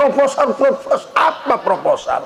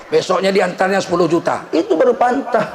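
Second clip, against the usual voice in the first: usual speaking voice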